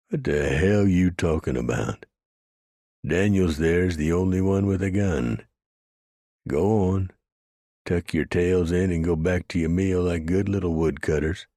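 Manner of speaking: loud, mocking sneer; taunting. A narrator's reading. Frank taunting the woodcutters.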